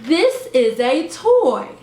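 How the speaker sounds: put-on "This is a toy" spoken slowly, each word shaped clearly for a toddler; slow over-enunciated. Shown as a demonstration. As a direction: show a parent how to talk slowly to a baby. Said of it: "let's practice".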